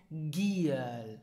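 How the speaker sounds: "Giel" with a hard g, drawn out; slow GHEE-el, g of "Giel" as in tiger; correct pronunciation. Shown as a demonstration. Teacher giving the answer, hard-g "Giel". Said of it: "it is pronounced".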